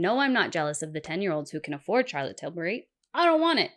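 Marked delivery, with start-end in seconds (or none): 3.1-3.7 high, loud protest; emphatic denial. Real, not acted. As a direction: push back hard, pitch leaping up. Denies being jealous.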